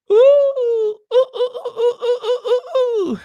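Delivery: very high, giggly squeals of delight; giddy squeal. Real, not acted. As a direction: squeal like a thrilled fan. Squealing over the royal photo.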